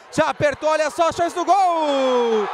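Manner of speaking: stretched-out goal shout; goal call. Real, not acted.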